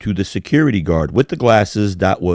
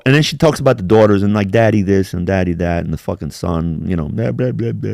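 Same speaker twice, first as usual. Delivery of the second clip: ordinary talk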